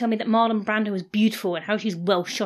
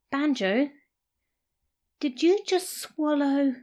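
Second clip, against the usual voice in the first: slow, high, dreading; stunned; 5 semitones higher, slower than usual. A narrator's reading. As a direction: ask it hardly daring. Sarah suddenly aghast.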